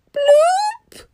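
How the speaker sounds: squeaky sinking bloop sound; a tiny high-pitched plop; high cartoon bloop. A narrator's reading. Rock sinking into lava.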